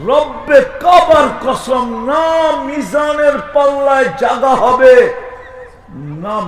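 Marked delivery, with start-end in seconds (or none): 0.1-4.9 slow, high, shouted sermon; swearing an oath at full preaching force; fiery preaching. Acted. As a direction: thunder it from the pulpit.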